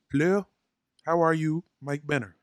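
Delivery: ordinary talk, nothing marked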